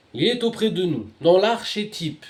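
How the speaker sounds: high-pitched, measured proclamation; declaimed scripture reading. Real, not acted. Reader announcing the verse to listeners, declaimed high. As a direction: proclaim it high, drawing out the end.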